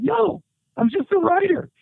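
exasperated correction. Retold or imitated. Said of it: "I'm like".

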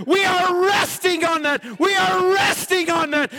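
high preacher's shout imitating a crowd; screamed declaration. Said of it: "they screamed".

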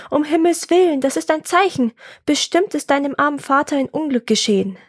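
worried cry. Acted. Mother fearing for the boy's father, worried cry. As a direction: cry out in concern for a loved one. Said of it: "rief sie voller Sorge".

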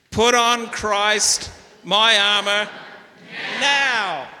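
slow and high, "now" lifted and hit hard; emphatic refrain. Shown as a demonstration. Pastor leading the whole church in the refrain, punching "now". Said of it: "emphatic with the now".

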